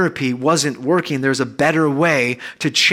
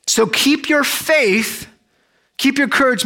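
slow encouragement; 10 semitones higher than usual. Retold or imitated. Paul encouraging the ship's men.

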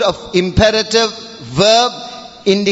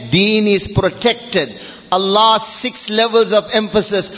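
ordinary talk

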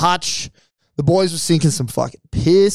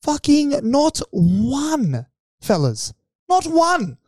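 higher, drawn-out disbelief; complaining to the mates about the balloons; exasperated rant; 9 semitones higher, slower than usual. Real, not acted. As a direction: rant at the wasted effort. Ranting about no photos.

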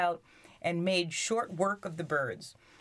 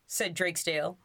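usual speaking voice

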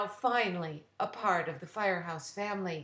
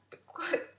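quick laugh at a slip; brief chuckle; a short real laugh; 10 semitones higher than usual. Real, not acted. The reader laughs at her own slip.

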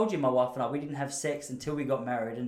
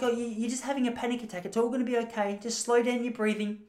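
put-on higher, soothing old lady voice; kindly old lady; 8 semitones higher than usual. Elderly lady at the beach soothing him, higher and gentle. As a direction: play a worried elderly stranger calming him down. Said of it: "She's like".

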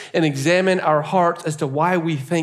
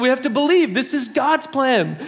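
higher, insistent voice of conviction; a friend pleading for faith; earnest James; 9 semitones higher than usual. Retold or imitated. James insists to the pastor it's God's plan, higher and earnest. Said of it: "he's like".

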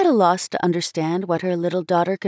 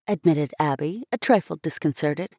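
ordinary delivery, nothing marked

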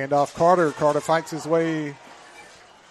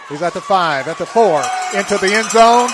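rising to a shout as he scores; building excitement into a yelled call; excited touchdown call; 2 semitones higher, 6 dB louder than usual. Real, not acted.

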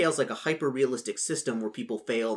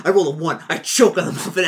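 louder, higher, insistent; loud eager demand; 4 semitones higher, 10 dB louder than usual. Retold or imitated. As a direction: announce the roll loudly. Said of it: "And be like".

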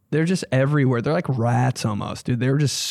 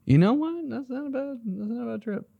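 put-on high then fading older man; high voice dropping to soft; older guy voice; 11 semitones higher, 4 dB quieter than usual. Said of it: "I'd be like".

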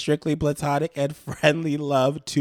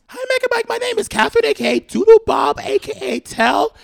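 high comment voice; 3 dB louder than usual. Acted. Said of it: "reading viewer's comment in voice".

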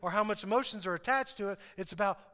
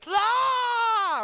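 high, loud mock scream inside the mind; a burst of inner panic; panicked shout; 13 semitones higher, 9 dB louder than usual. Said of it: "in your mind right now, you're going".

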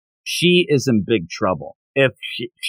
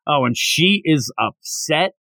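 ordinary talk, nothing marked

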